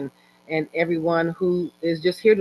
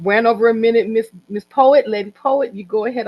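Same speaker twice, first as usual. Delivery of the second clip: calling out; 5 semitones higher, 7 dB louder than usual. Real, not acted. Calling next speaker to take over.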